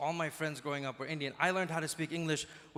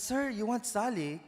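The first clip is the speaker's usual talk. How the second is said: higher, slow Filipino shop worker; Filipino accent; 6 semitones higher, slower than usual. Retold or imitated. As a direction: helpfully offer it in a Filipino accent. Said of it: "Filipinos are the nicest people".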